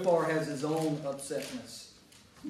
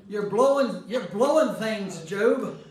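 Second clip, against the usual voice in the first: loud, higher teasing rebuke of Job; mock scolding; 4 semitones higher, 10 dB louder than usual. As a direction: scold him like an exasperated friend. Voicing the friends scolding Job.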